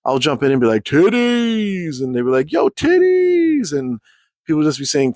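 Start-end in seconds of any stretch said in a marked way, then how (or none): 0.8-2.0 high, stretched-out playful holler; drawn-out call. Retold or imitated. Speaker jumping into the chat, playful drawn-out call. 2.5-3.7 very high, long held mock cheer; drawn-out call. Chat members calling it back, high drawn-out holler.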